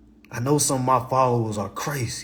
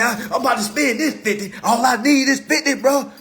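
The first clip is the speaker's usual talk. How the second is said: bragging friend; 10 semitones higher than usual. Retold or imitated. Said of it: "He said".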